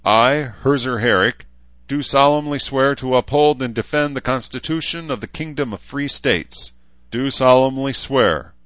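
swearing-in oath. A narrator's reading. Talbot swearing Herzer in, formal and projected. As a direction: administer a solemn oath to the ranks. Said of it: "Talbot swore him in".

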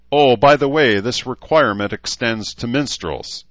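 calling out. A narrator's reading. Edmund turning back to call to crowd, loud. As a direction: raise the voice to reach the whole field.

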